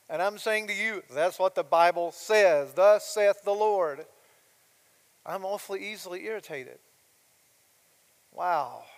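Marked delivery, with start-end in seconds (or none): 1.1-4.1 slow, loud preacher's declaration; weighty, emphatic pulpit voice; solemn proclamation. Acted. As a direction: pronounce it like a decree, drawing out each word. Preacher answers doubter with scripture.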